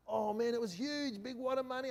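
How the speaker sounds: wide-eyed amazement at a wad of cash; amazed. Retold or imitated. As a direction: let the wonder rise in your voice.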